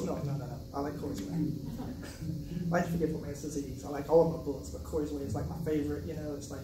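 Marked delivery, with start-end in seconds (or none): none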